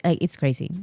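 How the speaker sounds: normal talking voice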